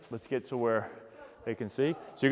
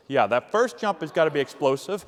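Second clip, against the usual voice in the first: ordinary talk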